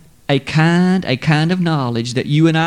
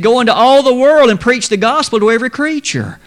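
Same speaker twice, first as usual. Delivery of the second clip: higher and louder, a proclaimed command; ringing command; 8 semitones higher, 4 dB louder, faster than usual. Acted. Preacher proclaiming the Great Commission to the congregation. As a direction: call it out like a commission.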